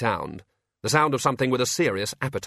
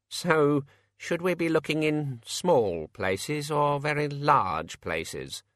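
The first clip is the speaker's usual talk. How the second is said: slow, higher musing that sinks at the end; lost in thought; 4 semitones higher, much slower than usual. A narrator's reading. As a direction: ponder slowly as your thought disappears down a tunnel. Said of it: "in the voice of one whose train of thought has just entered a long dark tunnel".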